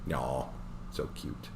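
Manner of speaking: whispered aww, gently; soft cooing whisper. Real, not acted. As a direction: coo it under your breath. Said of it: "reader aside, cooing".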